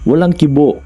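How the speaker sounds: ordinary delivery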